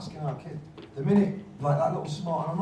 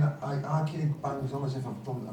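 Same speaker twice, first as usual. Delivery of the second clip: usual speaking voice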